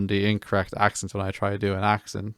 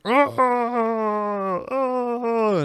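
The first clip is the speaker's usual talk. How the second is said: higher playful "Rawr, rawr" roar; mock roar. Mimicking Devilitas the boss, a higher playful roar. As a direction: roar it up high like a silly monster. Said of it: "Devilitas was like".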